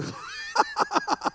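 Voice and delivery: high, airy cackling burst; hammed-up high laughing fit; imitated laughter. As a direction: laugh mockingly at the devil. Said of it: "웃으니까".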